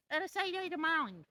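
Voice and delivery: put-on high sing-song baby talk. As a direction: coo it high at the dogs. Repeats the phrase to the dogs.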